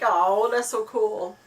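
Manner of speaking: loud, drawn-out 'oh, that's so cool'; stretched-out, hammed-up admiration; gushing delight. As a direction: gush slowly and loudly, retelling your delight. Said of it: "And I'm like".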